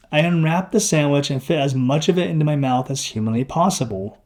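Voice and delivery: ordinary delivery, nothing marked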